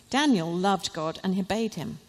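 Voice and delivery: usual speaking voice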